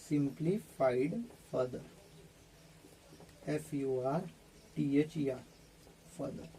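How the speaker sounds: low, soft, slow spelling aloud; quiet dictation. Real, not acted. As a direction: spell it out under your voice, letter by letter. Spells a word while writing. (धीमी, नीची आवाज़ में लिखवाते हुए)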